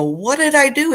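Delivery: put-on worried little-kid plea; scared little girl. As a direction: squeak it out like a kid who thinks she's in trouble. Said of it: "And I go".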